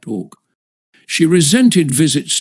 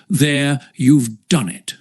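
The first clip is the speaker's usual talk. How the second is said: ordinary delivery, nothing marked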